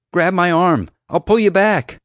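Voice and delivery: loud, pressing command; urgent shout. A narrator's reading. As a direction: shout instructions to someone in danger. Urging dangling friend to grab hold.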